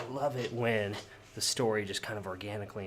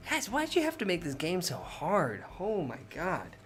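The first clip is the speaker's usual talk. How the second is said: mock whine; 7 semitones higher than usual. Real, not acted. Complaining about hard game.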